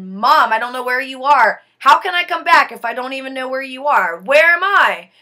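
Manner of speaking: loud, fast, desperate pleading; panicked plea. Retold or imitated. Lost between worlds, calling to her mother in panic. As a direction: cry out to mom, lost and scared.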